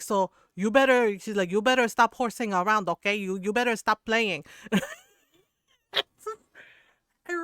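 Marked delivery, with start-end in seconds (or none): 0.0-1.2 loud, mock-stern warning; louder mom imitation; scolding mom. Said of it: "she would be like". 1.5-4.4 loud, insistent mock warning; louder Filipino mom voice; scolding mom. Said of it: "she would be like".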